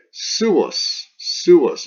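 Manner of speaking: SUUS said as two syllables, su-us; "Suus" in two beats, first one high and loud. Shown as a demonstration. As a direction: pronounce "Suus" with both vowels, su then us. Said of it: "It's not swus".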